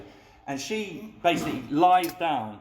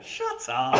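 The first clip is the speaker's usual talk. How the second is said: mock disbelief. Flatmate disbelieving.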